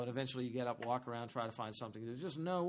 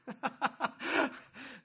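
high burst of real laughter; amused laugh; 10 semitones higher than usual. Real, not acted. Preacher finds verse hilarious.

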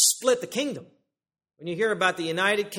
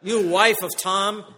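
slow, louder puffed-up address; mock pompous; 4 semitones higher, 9 dB louder, much slower than usual. Preacher mocking a man addressing his wife, pompously. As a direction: lord it over the wife as a joke. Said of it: "this guy's got a big head".

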